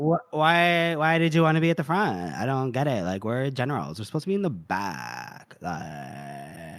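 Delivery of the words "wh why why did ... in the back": put-on Valley girl whine. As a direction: do a complaining Valley girl, trailing down. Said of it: "I think Monza was a Valley girl".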